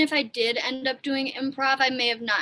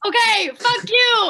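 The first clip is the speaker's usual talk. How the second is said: loud, high laughing retort; laughing mock outrage; 10 semitones higher, 9 dB louder than usual. Real, not acted. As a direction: fire back laughing.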